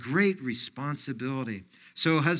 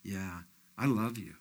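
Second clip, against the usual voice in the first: much softer and lower, reassuring; God reassuring the doubter, hushed; 8 semitones lower, 8 dB quieter than usual. Retold or imitated. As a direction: answer gently like the Lord. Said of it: "he whispers and he says".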